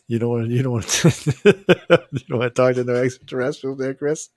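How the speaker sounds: real laughter breaking through the words; teasing Chris while cracking up, quick; laughing while talking. Real, not acted. To Chris about aliens, laughing hard. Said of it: "I shouldn't be laughing".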